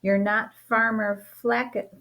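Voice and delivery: ordinary delivery, nothing marked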